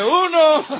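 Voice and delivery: very loud, high comic yell with laughter; playful shouted count. Pretend. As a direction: bellow it as a running gag. Prompts husbands to shout the count.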